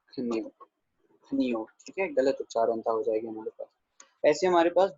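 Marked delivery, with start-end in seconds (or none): 0.1-1.6 खन्यो, then खनियो with an i before yo; wrong pronunciations. Shown as a demonstration. Teacher listing wrong readings, खन्यो then खनियो, both without long aa.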